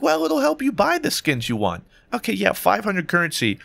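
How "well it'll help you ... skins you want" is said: mocking voice. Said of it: "Some people are like".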